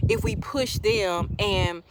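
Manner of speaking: ordinary talk, nothing marked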